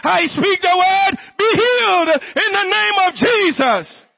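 high, forceful preacher's cry; commanding healing declaration. Real, not acted. Preacher commanding the sick to be healed, high forceful declaration. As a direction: proclaim it with full authority.

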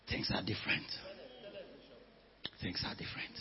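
barely audible, whispered reply; hushed, hesitant whispering; timid whisper. Acted. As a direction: whisper it as a reluctant excuse. Esther's timid reply to uncle.

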